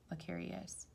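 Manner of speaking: ordinary delivery, nothing marked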